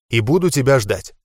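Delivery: plain reading voice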